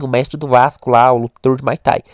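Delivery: ordinary talk, nothing marked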